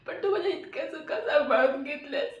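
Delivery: ordinary talk, nothing marked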